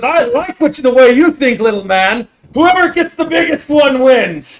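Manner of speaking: loud, high, hearty giant voice; dopey cartoon bellow. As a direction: boom it like a friendly giant. GM voicing Donar.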